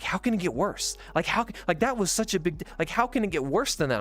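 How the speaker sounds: can't imagine it getting worse; incredulous. Retold or imitated.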